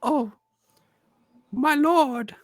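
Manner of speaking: put-on high, breathless little boy; a small kid gasping up at a giant; awed child. GM voicing the boy awed by the huge warrior, high. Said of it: "he goes".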